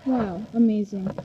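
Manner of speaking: ordinary delivery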